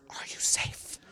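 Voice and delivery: voiceless, barely-there whisper; hushed covert check-in; mouthed whisper. Acted. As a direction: mouth it secretly with only breath. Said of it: "mouth the words".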